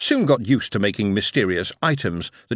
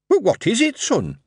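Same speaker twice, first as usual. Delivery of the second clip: puzzled mother; 13 semitones higher, 3 dB louder than usual. Acted.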